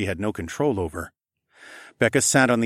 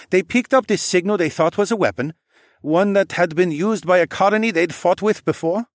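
put-on young man voice; 9 semitones higher, 4 dB louder than usual. Tay voiced, checking the story.

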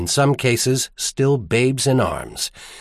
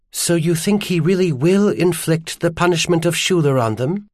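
higher-pitched woman's voice, rising question; distressed woman character; 6 semitones higher than usual. Acted. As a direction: ask in dismay, pitched up. Narrator voices Iris questioning.

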